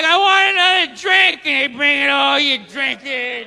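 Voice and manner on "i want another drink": mock tourist demand. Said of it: "And you'd be like".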